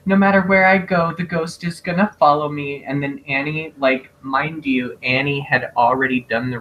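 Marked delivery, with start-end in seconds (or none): 0.0-2.8 higher, plaintive retelling of crying; mock tearful. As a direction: wail it lightly, imitating a weeping character. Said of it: "when she's crying".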